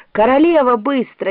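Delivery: ordinary delivery, nothing marked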